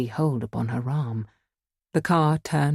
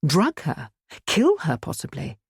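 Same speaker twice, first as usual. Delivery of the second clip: ordinary delivery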